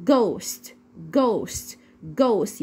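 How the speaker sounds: "ghost" starting with a hard g; spaced model repeats of "ghost", gim sound. Shown as a demonstration. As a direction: repeat "ghost" as "gost" for learners. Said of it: "بتدينا حرف".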